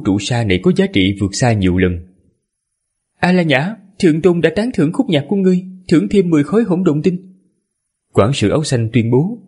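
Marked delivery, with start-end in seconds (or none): 3.2-7.3 high feminine attendant voice; man doing a woman's voice. Acted. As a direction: voice the servant as a woman, pitched up. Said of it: "Lát ra giả giọng nữ".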